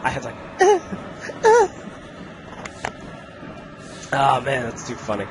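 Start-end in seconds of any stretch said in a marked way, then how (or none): 0.4-4.1 squealing helpless laugh fit; high crying laugh. Real, not acted. Gamer cracking up at his own joke, squealing laugh.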